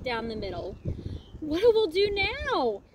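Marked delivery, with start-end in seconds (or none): 1.4-2.8 high, slow, loud cry; panicked yell. Acted. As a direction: yell in the storm. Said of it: "yelled one of the pirates".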